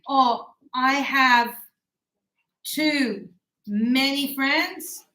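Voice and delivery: drawn out, very slow; slow example. Shown as a demonstration. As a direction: stretch every word out. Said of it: "example sentence, slowed".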